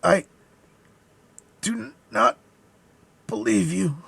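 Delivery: high, halting last words; dying man's cry. A narrator's reading. Dying soldier refusing to believe, high broken cry. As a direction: force it out between long pauses. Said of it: "cried the soldier".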